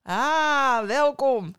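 loud, high, hearty greeting; jovial welcome. A narrator's reading. Innkeeper greeting the returning traveler, jovially. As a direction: call out a big jovial welcome. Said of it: "riep deze joviaal uit".

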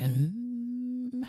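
tempted inner voice. Retold or imitated. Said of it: "denk je".

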